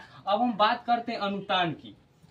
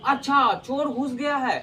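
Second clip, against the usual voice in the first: higher and louder, startled अच्छा; exclamation of shock; 5 semitones higher, 4 dB louder than usual. Shown as a demonstration. Teacher demonstrating an exclamation about a thief, higher and louder. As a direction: gasp out the news of a thief in the house. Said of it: "विस्मयादि बोधक का चिन्ह".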